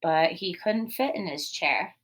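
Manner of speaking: normal talking voice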